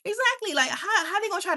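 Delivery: a high, gleeful "Exactly"; excited agreement. Real, not acted. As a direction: leap up high in delighted agreement.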